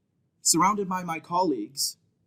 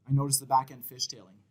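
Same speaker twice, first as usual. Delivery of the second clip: normal talking voice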